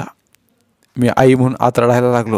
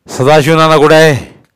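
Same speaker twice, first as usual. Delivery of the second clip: loud, raised questioning; officer demanding a man's whereabouts; police demand; 5 semitones higher, 7 dB louder than usual. A narrator's reading. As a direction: call out sternly like an officer.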